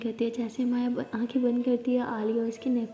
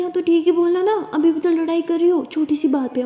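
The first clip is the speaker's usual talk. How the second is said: higher, louder exasperated retort; raised voice; 6 semitones higher, 9 dB louder than usual. Acted. Partner points out she is fighting over nothing, raised exasperated voice. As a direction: push back, half laughing, half loud. Partner argues back in quarrel.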